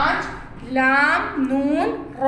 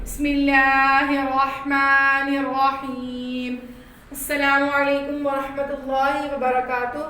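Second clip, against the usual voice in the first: slow recitation; 3 dB quieter, much slower than usual. Acted. Teacher opening class for children with slow reverent recitation. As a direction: intone the blessing slowly and reverently.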